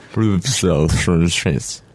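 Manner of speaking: very slow, slurred put-on voice; hammed-up impression. Co-host showing off an impression, slow and hammed-up. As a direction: stretch it out into a garbled impression. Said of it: "I thought that was a good impression".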